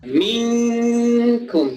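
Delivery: very slow minkum with the ng held two beats; recited, long hum. Shown as a demonstration. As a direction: stretch miŋkum. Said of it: "ditahan dua harakat".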